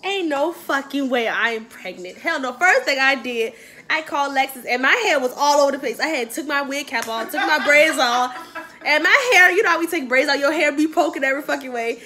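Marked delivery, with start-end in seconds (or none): none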